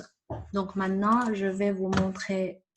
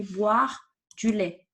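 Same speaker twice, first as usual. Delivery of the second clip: normal talking voice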